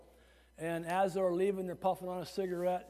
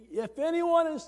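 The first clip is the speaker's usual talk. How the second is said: high, loud imitated yell; demonstrating a yell, pitched way up; raised voice; 10 semitones higher, 6 dB louder than usual. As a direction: raise your voice high to show the yelling. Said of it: "he's raising his voice".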